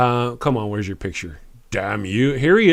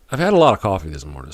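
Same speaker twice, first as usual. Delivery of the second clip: quick, low-pitched patter; coffee-fuelled rush; 6 semitones lower, faster than usual. Real, not acted. Streamer admitting too much coffee to viewers, rushed. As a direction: rattle it off, over-caffeinated. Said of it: "so let me slow down".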